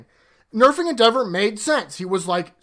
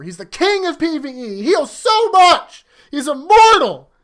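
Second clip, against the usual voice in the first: mock hype; 7 semitones higher, 8 dB louder, slower than usual. Mocking the hype around Endeavor.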